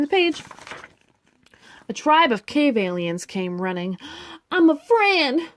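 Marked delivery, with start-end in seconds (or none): 4.5-5.5 yelled. Acted. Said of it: "yelled Rex".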